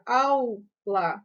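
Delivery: "aula" as au, la; sounded-out syllables in "aula"; split into syllables. Shown as a demonstration. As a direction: hold au together, then la in "aula". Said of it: "O A com U".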